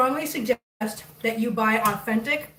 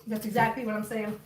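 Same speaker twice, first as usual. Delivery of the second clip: ordinary talk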